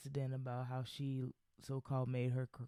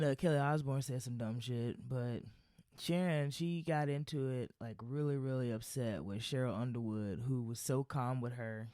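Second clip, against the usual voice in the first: ordinary talk, nothing marked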